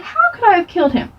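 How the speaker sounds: high, quick, disbelieving comeback; incredulous retort. Acted. Child arguing with mom, incredulous retort. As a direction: throw it back in disbelief. Child disputing mom's claim.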